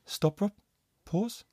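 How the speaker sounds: hushed stop order from the booth; hushed coach. Retold or imitated. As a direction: murmur it like a voice in an earpiece. Coach hushing him through earpiece.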